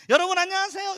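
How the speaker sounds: high, loud bright greeting despite the pain; forced cheerful greeting. Retold or imitated. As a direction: put on a cheerful face for the kids. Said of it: "이렇게 하면서".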